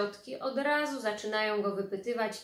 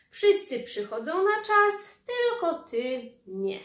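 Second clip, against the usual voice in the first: much higher, loud reproach slowing at the end; indignant aunt; 8 semitones higher, 7 dB louder than usual. Acted. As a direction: scold him like a shocked aunt. Ciotka łaje spóźnionego wujka. (oburzona ciotka beszta wujka)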